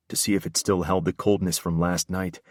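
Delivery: plain reading voice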